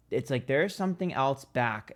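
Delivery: ordinary delivery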